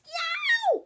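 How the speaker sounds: sharp high yelp; a sudden ouch from a stubbed foot; yelp of pain. Acted. Child treading on Lego at night, high yelp.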